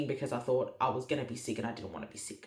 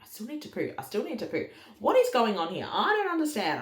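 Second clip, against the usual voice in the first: bewildered whine; 6 semitones higher than usual. Retold or imitated. Retells confusion on the toilet.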